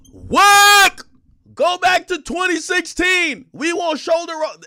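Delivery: high, loud jeering yell; mock fan shout. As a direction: jeer like an angry crowd. Fans mocking Uzi.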